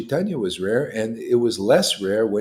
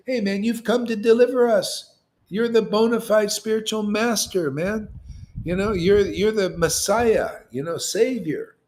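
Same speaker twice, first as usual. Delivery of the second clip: excited Peter; 8 semitones higher than usual. Retold or imitated. Voicing Peter's praise.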